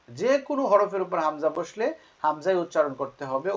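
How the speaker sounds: normal talking voice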